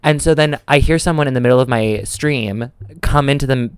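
ordinary delivery

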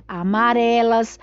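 plain reading voice

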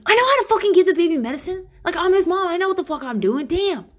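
high, loud, fast angry snap; snapping back. Retold or imitated. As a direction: snap defensively at your husband. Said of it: "I'll immediately be like".

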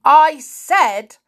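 loud, high, insistent shout; angry shout. A narrator's reading. Uncle repeating his question to the children, angry shout. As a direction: shout it slowly, demanding an answer. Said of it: "shouted his uncle".

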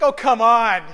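loud, mock-exasperated groan of a wife. Pastor voicing his wife rolling her eyes at sappy cards, loud mock exasperation. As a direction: play the unimpressed wife, loud.

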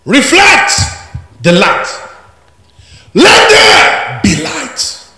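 soaring high, loud proclamation; preaching shout. Real, not acted. Preacher roaring the verse to his congregation, shouting.

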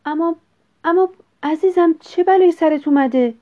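high, loud, aghast wife; alarmed cry. A narrator's reading. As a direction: cry out in shock at your husband. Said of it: "چهرهٔ خانم گرگ کبود شد و فریاد زد".

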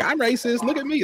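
very high mocking falsetto. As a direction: squeak it out like a self-outing fool. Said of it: "standing up, like".

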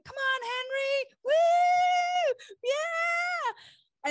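high, loud cheering shout; excited whooping yell; yelling encouragement. Retold or imitated. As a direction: cheer him on from the water below. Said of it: "you were yelling me".

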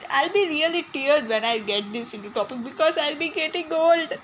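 ordinary delivery

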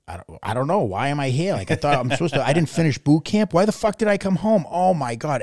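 loud, high-pitched bewildered panic; acted panic. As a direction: imitate yourself panicking, voice rising to a yell. Acting out panic in nightmare.

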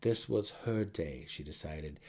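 ordinary delivery

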